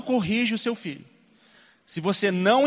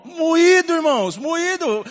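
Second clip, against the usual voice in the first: much higher, louder cry of anguish; raised heartbroken preaching; anguished exclaim; 10 semitones higher, 6 dB louder, slower than usual. Real, not acted. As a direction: cry out how crushed he felt. Pastor's anguish proclaimed.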